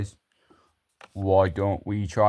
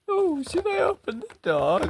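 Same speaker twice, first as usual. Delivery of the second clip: high put-on woman's voice, much higher; falsetto wife voice. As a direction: squeak it out like a timid wife. Wife's voice behind the door.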